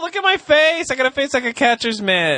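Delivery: high-pitched comic self-mockery; mock outburst. Answering teasing about getting old, high mock wail. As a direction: wail about yourself in exaggerated despair.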